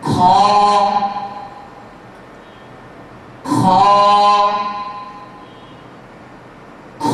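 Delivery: খ thick and heavy from the upper throat; a heavy throaty খ with open mouth; letter name Kha. Shown as a demonstration. Teacher models heavy খ for the class. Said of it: "আমি উচ্চারণ করছি".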